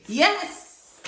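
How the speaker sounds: high, loud cry of triumph; joyful shout. Acted. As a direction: shout it out in delight. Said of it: "he shouted".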